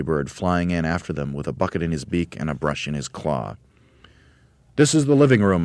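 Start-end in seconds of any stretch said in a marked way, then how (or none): 4.8-5.6 high, loud announcement; Mugglewump announcing. A narrator's reading. Monkey leader showing the house, announcing. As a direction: announce the room grandly. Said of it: "announced Mugglewump".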